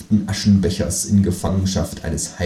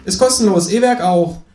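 kangaroo voice; 10 semitones higher, 5 dB louder than usual. Acted. Kangaroo voice in read story.

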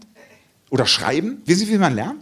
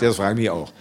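much lower, deadpan retort; dry low teacher; 10 semitones lower, faster than usual. Retold or imitated. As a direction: answer flatly as a weary teacher. (tiefer, trockener Lehrerkommentar)

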